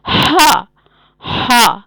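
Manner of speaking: "ہا" pushed out of the throat, loud heavy h; strong throaty breath opening "ہا", then long open vowel. Shown as a demonstration. Teacher showing it is no simple h, throaty "ہا". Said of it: "اس کو ہم حلق سے آواز نکالیں گے".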